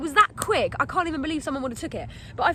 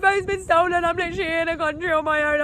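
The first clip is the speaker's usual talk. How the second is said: raised, whining mock sob; wailing complaint, easing down; re-enacted tearful plea; 4 semitones higher than usual. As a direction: pour it out in acted distress. Re-enacts sobbing out the story.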